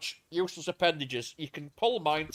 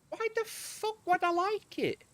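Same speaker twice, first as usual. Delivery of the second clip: high, slow mock outrage; indignant disbelief; 13 semitones higher than usual. Quoting what he told the ex-girlfriend, in incredulous mock outrage. Retelling his indignant reply.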